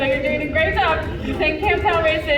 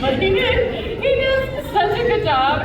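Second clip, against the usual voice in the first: high-pitched praise; 9 semitones higher than usual. Real, not acted. Praising the parrot.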